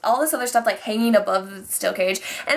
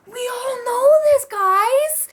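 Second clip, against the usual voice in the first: high, exasperated fan shriek; shrill outburst of annoyance; mad; 12 semitones higher, 4 dB louder, slower than usual. Real, not acted. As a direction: wail it at the top of your voice, fed up. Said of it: "I'm mad at the end".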